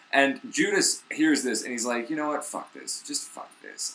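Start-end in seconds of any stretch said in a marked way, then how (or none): 2.1-3.9 dropping to a soft muttered whisper; muttered disgust. Retold or imitated. Judas quitting in disgust, muttering under his breath. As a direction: grumble it under your breath.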